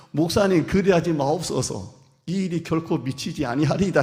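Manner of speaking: 크고 높게, 힘주어 말리는 외침; 목사님을 필사적으로 붙잡듯; 간곡한 만류. Pretend. 회중이 목사를 말리는 장면. (교인이 떠나려는 목사를 크게 외쳐 말리는 목소리)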